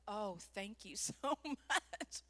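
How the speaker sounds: slow, soft, low gratitude; drawn-out relief, voice dropped; relieved thanks. Retold or imitated. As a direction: breathe out heartfelt acted thanks. Acts grateful relief.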